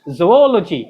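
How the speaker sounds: ZOOLOGY as zo-OL-o-jee, falling; correct pronunciation. Shown as a demonstration. Teacher drills the class, ज़ूलॉजी as "zo-ol-o-jee". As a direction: say ज़ूलॉजी with zo-o at the start. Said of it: "क्या पढ़ते हैं?".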